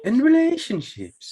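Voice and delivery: high, drawn-out Irish lilt; Irish-sounding. Real, not acted. Co-host echoing the show's tagline, Irish-sounding. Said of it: "You sounded really Irish, sir".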